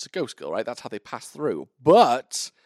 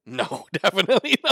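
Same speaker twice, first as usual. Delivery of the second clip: high, loud no breaking into laughter; laughing denial; 9 semitones higher, 6 dB louder than usual. Real, not acted. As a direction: laugh it off emphatically, pitched up. Amused denial of boobies question.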